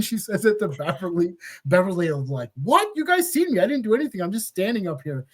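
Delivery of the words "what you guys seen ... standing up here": high-pitched protest, settling back down; imitated "who, me?" protest; mock innocence. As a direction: act wrongly accused, starting high. Acting Beverly playing innocent in court.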